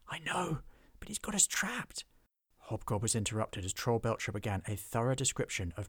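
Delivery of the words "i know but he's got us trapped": whispered reply, high; high frightened whisper. Acted. Goblin whispering back to his friend, high and scared.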